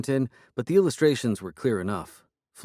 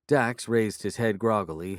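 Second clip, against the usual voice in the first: plain reading voice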